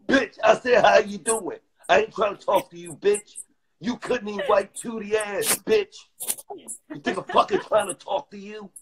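high-pitched, heated retelling; indignant clapback. Retold or imitated. Man retelling how his greeting got snubbed, indignant. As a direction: fire it back at her, voice jumping up.

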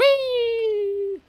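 very high squeal of delight; gleeful whee. Real, not acted. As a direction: squeal like you're on a ride. Chair sliding, playful.

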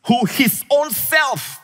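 high, slow emphasis on "own self"; stress on own self. Shown as a demonstration. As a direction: lift and stretch "own self". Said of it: "notice the emphasis".

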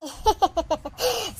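quiet run of laughter; amused chuckle. Acted. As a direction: chuckle fondly at the child. Grandmother amused by the child.

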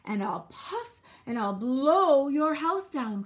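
loud wolf, swelling on the long blow; big storybook villain bluster; wolf's threatening cry. A narrator's reading. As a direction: build slowly to a loud, stretched threat. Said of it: "cried the wolf".

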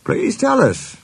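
high-pitched eager request; pleading. A narrator's reading. Engines beg to hear the story, pleading.

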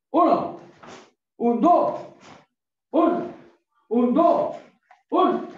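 high shouted count; barking gym coach. Real, not acted. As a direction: bark out the count loud and high. Coach counting drill reps aloud.

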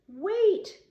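very high, loud squeak; panicked squeak. A narrator's reading. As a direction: squeak it out to stop a blow. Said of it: "Roy squeaked".

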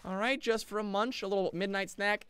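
ordinary talk

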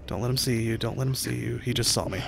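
soft hushed self-warning; whispering. Real, not acted. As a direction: murmur it quietly to yourself. Quiet self-instruction hiding.

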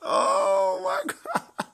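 high, slow laugh of disbelief; real delighted laugh. Real, not acted. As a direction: laugh it out in shock. Shocked by a summon result.

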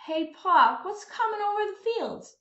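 put-on young son calling to his father; boy asking. Boy asks his father what is coming, curious. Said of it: "the boy and his father woke up".

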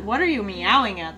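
ordinary delivery

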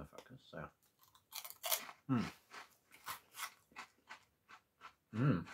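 chewing between words; eating a snack while talking. Real, not acted. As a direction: mouth partly full. Seen 0: holds snack while talking, bites it at 1.6s, chews after.

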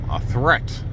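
usual speaking voice

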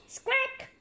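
high, thin parrot squawk. Acted. Polly the parrot squawking. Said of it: "cries Polly".

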